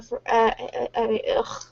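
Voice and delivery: stuttering, stuck on "at"; tripping over the words. Real, not acted.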